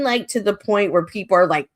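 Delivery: normal talking voice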